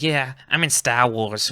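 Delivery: slow, lazy Boston accent impression. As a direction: drawl it like a Boston comic. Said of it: "with his Boston accent".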